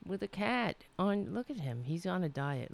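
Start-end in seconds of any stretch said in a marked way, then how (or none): none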